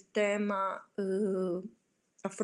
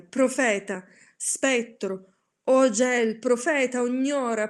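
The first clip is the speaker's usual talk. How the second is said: shouting; 3 semitones higher, 5 dB louder than usual. Acted. Narrator screams at the raven, louder and desperate. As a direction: hurl the words at the bird.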